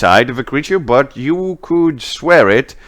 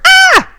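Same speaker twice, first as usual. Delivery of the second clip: short, loud, piercing scream; high scream; 8 dB louder than usual. Acted. Witch screaming as the ice gives way, short high shriek. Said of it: "she makes a scream like".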